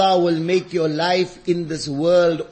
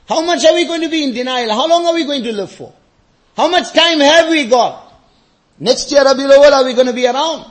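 raised, exhorting questions; insistent preaching; 7 semitones higher, 8 dB louder than usual. Real, not acted. Preacher challenging congregation about mortality, insistent. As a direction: press each question on the congregation.

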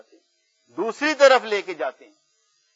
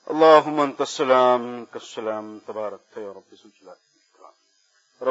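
prayer recitation; 7 semitones lower than usual. Real, not acted. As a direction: intone each plea with long vowels. (دعا کی ٹھہری ہوئی عربی تلاوت)

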